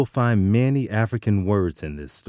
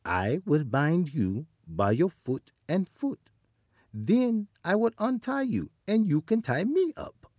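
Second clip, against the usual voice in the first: higher and higher sly voice; high spider voice; 10 semitones higher than usual. Acted. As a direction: coax the leopard sweetly. Anansi voiced.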